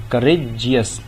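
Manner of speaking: "Courageous" broken as courage, then Jesus; brief break inside "courageous", before Jesus; split into syllables. Shown as a demonstration. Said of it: "तो इसकी दो syllables आ गए". ("Courageous" को करेज और Jesus में तोड़कर)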